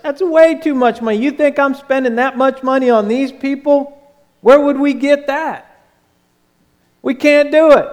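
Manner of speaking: mock-offended disbelief; indignant disciples. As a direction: balk at the price like it's outrageous. Disciples balk at the cost.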